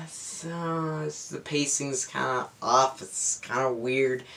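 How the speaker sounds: unimpressed mutter. Retold or imitated. Reviewer recalling his thoughts at the clumsy opening, muttered softly. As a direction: mumble it, underwhelmed and tired. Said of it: "and I was just like".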